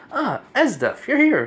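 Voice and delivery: put-on high, loud child's greeting; kid emperor. Kid emperor greeting Esdef in the throne room, high child voice. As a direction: pipe up as a delighted boy ruler. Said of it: "the kid emperor goes like".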